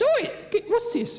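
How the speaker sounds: hoge geschrokken stem; shocked London woman. Acted. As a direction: schreeuw het hoog en geschrokken. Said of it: "And she's".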